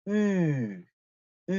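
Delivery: long "으" as German ü, lips staying rounded; held ü sound. Shown as a demonstration. Teacher correcting a wi habit, steady rounded ü. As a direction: hold "으" without gliding into a spread ee. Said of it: "그대로 우 하는 입 모양을 유지해야 된다".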